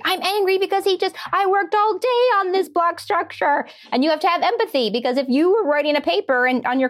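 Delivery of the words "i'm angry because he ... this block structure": very high, indignant mock preschooler; angry child. Preschooler telling a parent about wrecked blocks, acted angry and high. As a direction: play a small kid's outraged complaint. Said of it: "your kid gets angry and starts".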